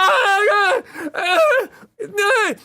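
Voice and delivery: high, loud wails of pain; hammed-up agonised cries; wounded screaming. Wounded man screams while dragged.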